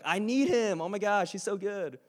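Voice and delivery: very high, loud, gushing devotion. Retold or imitated. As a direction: gush it over the top like a fangirl. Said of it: "You won't say it like that".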